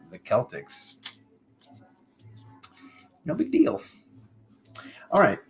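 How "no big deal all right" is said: much higher, sing-song no big deal; mock nonchalance. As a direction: act casual in a teasing way. Mock-casual brag.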